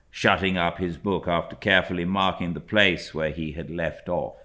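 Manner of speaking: usual speaking voice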